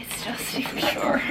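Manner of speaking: dismayed whisper. Real, not acted. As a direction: whisper it in disbelief. Discovering dust behind drawers.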